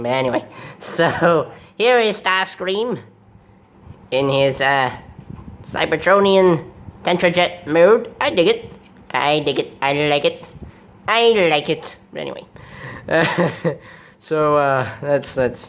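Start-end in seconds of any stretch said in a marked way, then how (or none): 0.8-12.0 stretched-out cartoon villain delivery; Starscream. Host showing the jet mode in an imitated villain voice. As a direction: relish it like a robot villain. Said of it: "forget about the Starscream voice".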